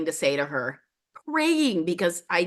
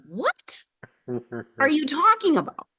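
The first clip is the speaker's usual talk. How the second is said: baffled disbelief; 8 semitones higher than usual. Retold or imitated. Client stunned by the shaman's diagnosis, high baffled cry. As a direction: sound completely thrown by what she said. Told about compassionate possession.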